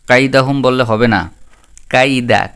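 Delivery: usual speaking voice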